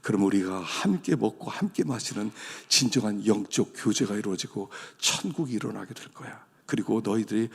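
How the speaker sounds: gentle invitation. Acted. 문 밖의 주님이 성도들을 부르며, 낮고 부드러운 약속. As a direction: coax them to open the door.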